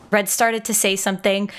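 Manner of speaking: normal talking voice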